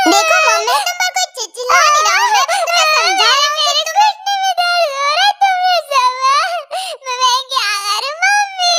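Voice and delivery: put-on high-pitched tearful girl, half wailing; hammed-up high crybaby calling for mummy; whiny crying girl. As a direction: whine high and tearful like a spoilt child. Injured girl whines, crying for mummy.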